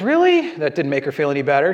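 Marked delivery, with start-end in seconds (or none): 0.0-0.6 high, incredulous "Really?" as the wife; wife's shocked question. Retold or imitated. Wife reacting to her husband's answer, shocked and high. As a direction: jump way up in pitch, stunned. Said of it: "And she goes".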